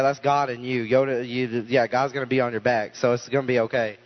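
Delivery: ordinary delivery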